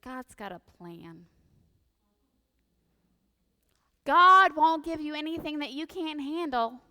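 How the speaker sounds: mildly mocking pious reassurance; mock platitude. Well-meaning friend offering a platitude, mock pious. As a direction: recite the cliché with a smug lilt. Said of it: "someone in their great wisdom says to you".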